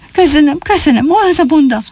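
put-on high, eager young rabbit voice; high-pitched burst of happy thanks; overjoyed. A narrator's reading. Young rabbit thanking grandpa for coins, overjoyed. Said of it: "Csupa Fül ugrált örömében".

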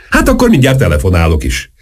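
ordinary delivery

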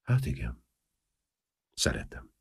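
halk, félénk beismerés; szemérmes vallomás. A narrator's reading. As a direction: szinte suttogva, zavartan. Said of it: "szemérmesen mondta". (szemérmesen, halkan bevallva)

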